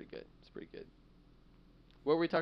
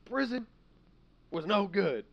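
put-on high, loud croaky old man; hammed-up old convict; 12 semitones higher, 5 dB louder, slower than usual. Mock old prisoner.

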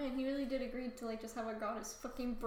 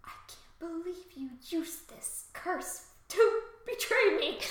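slow, higher, melodramatic complaint; hammed-up betrayed outrage; 5 semitones higher, 6 dB louder, slower than usual. Comic Apollo reproaching Cassandra, mock-wounded and dramatic. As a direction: stretch it out as if deeply wronged.

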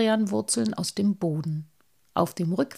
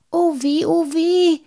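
high, loud wail of dismay; woeful, high-pitched calling; alarmed cry; 10 semitones higher, 7 dB louder than usual. A narrator's reading. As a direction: cry out in squeaky dread. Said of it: "rief Halbschuh".

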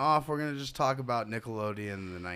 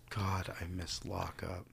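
whispering; 4 semitones lower, 7 dB quieter, slower than usual. Real, not acted. Wistful aside about old TV.

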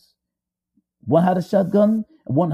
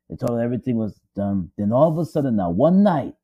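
usual speaking voice

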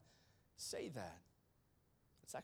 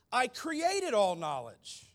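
booming slow proclamation; 8 semitones higher, 12 dB louder, slower than usual. Acted. God's claim.

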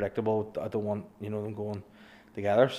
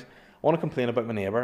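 fast quote; much faster than usual. Retold or imitated. Quoting caller on phone.

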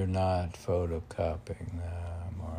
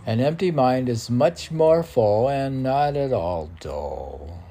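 louder, declaimed verse; poem recitation; 5 semitones higher, 9 dB louder than usual. Acted. Poet declaiming his verse to the host, loud. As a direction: proclaim the line.

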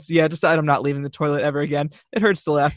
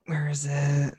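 whisper; 8 dB quieter, much slower than usual. Real, not acted. Searching quietly, unvoiced.

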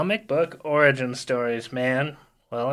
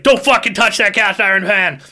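angry shout; 6 semitones higher, 7 dB louder than usual. Retold or imitated. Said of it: "my brother's like".

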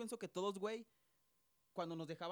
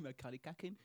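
put-on lower, laughing echo in English accent; imitated English accent; 6 semitones lower, 5 dB quieter than usual. Teasing his fluent friend, copied English accent. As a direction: parrot it with a mock English accent. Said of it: "Ahí estás bien fluido".

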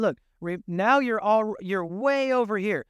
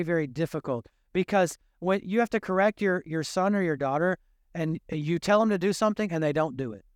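usual speaking voice

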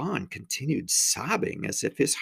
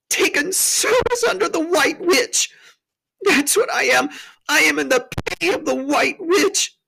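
high, tearful self-accusation; hammed-up sobbing faun; wailed confession; 4 dB louder than usual. A narrator's reading. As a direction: blubber out the shameful truth. Tumnus wails his confession.

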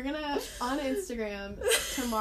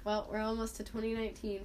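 ordinary talk

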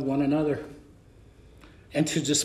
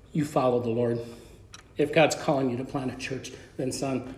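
lower, softer mentor's counsel; gentle fatherly advice; 6 semitones lower, 7 dB quieter than usual. Retold or imitated. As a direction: advise him quietly like a father.

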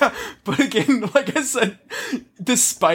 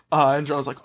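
normal talking voice

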